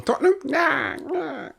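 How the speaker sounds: high, dismissive footballer impression; hammed-up foreign English, fading off; Mbappe. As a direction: squeak the question, then wave it away softly. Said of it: "When he's speaking English".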